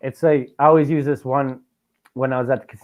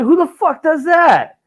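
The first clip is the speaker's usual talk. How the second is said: high, slow squeal of disbelief; squeaky mock outrage at the glass door; outraged disbelief; 5 dB louder, slower than usual. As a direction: shriek it, baffled and offended, drawn out. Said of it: "I'm like".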